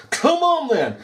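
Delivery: high-pitched goading, no extra volume; mock shout, daring him to act; retold shouting. Daring an officer to arrest him, retold shout. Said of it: "I'm shouting at him".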